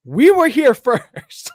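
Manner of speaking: high, slow, laughing outrage; mock-indignant, cracking up; retelling his own protest through laughter. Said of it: "And I'm like".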